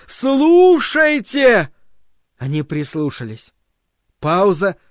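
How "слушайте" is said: very slow, higher and louder trumpet-like call. A narrator's reading. Voice summons the worshippers, slow trumpeting cry. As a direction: blare the word out like a trumpet. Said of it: "трубно воззвал голос".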